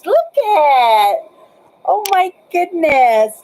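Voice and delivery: high, loud surprised exclamation; surprised cry. Real, not acted. Sees room clearly on webcam.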